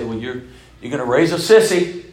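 loud, rising jeer; imagined heckler, building to the last word; mock scoffing objection. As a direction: scoff loudly like a disapproving critic.

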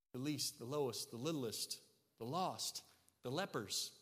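quiet sincere preacher's close; hushed reverence. Real, not acted. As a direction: bring it down to an intimate hush. Soft closing run.

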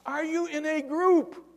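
high, loud acted holler; sustained long-distance shout; yelling. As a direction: call up to a man far above. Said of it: "The guy yells".

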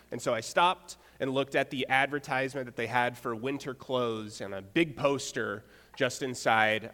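usual speaking voice

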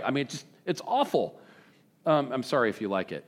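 ordinary talk, nothing marked

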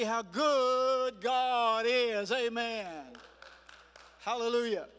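loud, high, drawn-out praise; exultant preaching. Real, not acted. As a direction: proclaim it slowly at full preacher's force. Preaching climax.

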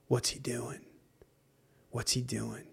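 hushed, unvoiced whisper; whispering demons. Demons whispering to each other about the believer. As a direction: breathe it like a plotting demon.

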